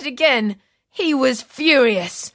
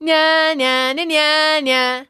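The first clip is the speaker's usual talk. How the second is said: taunting nya nya; 4 semitones higher, slower than usual. A narrator's reading. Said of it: "And she said".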